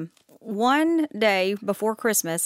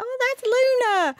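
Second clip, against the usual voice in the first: much higher, sing-song reply; innocent reply; 14 semitones higher than usual. Retold or imitated. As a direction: chirp the cat's name innocently. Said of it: "and I'm going".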